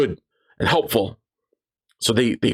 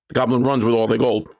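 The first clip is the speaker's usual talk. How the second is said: usual speaking voice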